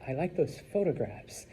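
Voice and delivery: quietly showing off with photographs; a soft, fussy, overdone tone; a hint of snobby flair; extra fancy. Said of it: "if Cheyenne wants to be extra".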